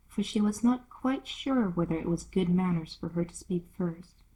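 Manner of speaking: plain reading voice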